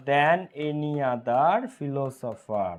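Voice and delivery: each word drawn out for writing down; very slow dictation. Shown as a demonstration. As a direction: spell it out slowly for students. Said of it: "dictating to class". (ধীরে ধীরে dictation)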